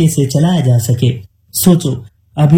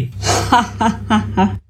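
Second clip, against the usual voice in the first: softer staccato villain's cackle; scoffing laugh; 6 semitones higher, 5 dB quieter than usual. Acted. Dushika hears of water treatment, scoffing cackle. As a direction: laugh off their plans.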